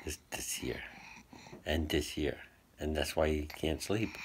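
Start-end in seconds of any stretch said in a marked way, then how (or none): 0.1-0.8 soft unvoiced whisper. Real, not acted. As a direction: whisper it close to his ear. Whispering to sick child.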